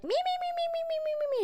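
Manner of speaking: very high, squeaky nagging imitation; mocking complainer. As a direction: rattle it off in a high mocking monotone. Mimics complaining tourists.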